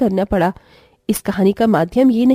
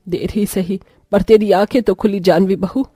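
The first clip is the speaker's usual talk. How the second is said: an elder reproaching her daughter-in-law, pitched lower; scolding grandma; 8 semitones lower than usual. Acted.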